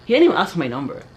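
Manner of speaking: ordinary delivery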